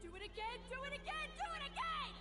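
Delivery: high, relentless repeated command; piercingly high, furious insistence; yelled order. Acted. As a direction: hammer the order over and over. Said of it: "she orders us to redo the dish again".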